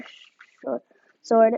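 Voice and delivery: plain reading voice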